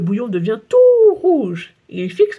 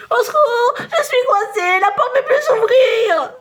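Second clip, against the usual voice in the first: much higher, slow, whimpering wail; crying child; 14 semitones higher, 5 dB louder, slower than usual. Acted. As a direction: sob for help trapped inside. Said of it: "Clotaire se met à pleurer". (Clotaire coincé, appel pleurnichard très aigu)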